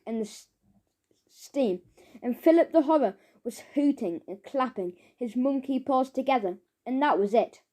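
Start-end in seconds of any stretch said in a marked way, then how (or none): none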